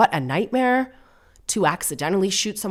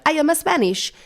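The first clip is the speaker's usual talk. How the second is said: mock Spanish accent, pitched high; hammed-up fake Spanish accent; Hilaria; 12 semitones higher, 4 dB louder than usual. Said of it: "quite an offensive accent if you're just putting it on".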